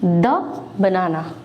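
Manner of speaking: द banana with a short schwa "duh" before banana; teacher's model: द banana, short "duh"; the before consonant. Shown as a demonstration. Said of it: "इसके पहले बोलेंगे".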